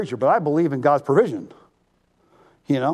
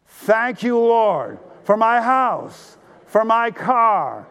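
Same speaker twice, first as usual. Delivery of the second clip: higher, slow and deliberate, each phrase weighed; earnest call-and-response thanks; slow, emphatic thanks; 5 semitones higher, much slower than usual. Shown as a demonstration. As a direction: lead the crowd, meaning every word. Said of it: "And really mean it?".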